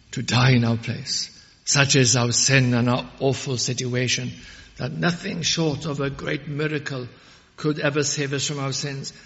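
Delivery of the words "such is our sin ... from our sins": ordinary talk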